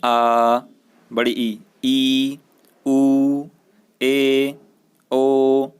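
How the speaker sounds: आ, ई, ऊ held long and loud; long vowels. Shown as a demonstration. Teacher shows students how long vowels are held. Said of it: "इसको कैसे बोलते हैं".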